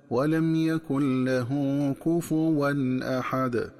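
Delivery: slow, chanted "كفوا" as "kufuwan"; fa with damma. Shown as a demonstration. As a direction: recite "كفوا" with u on the fa and one waw. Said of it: "تقرأ بضم الفاء هكذا".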